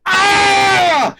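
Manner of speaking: a hammed-up dying cry; mock death scream.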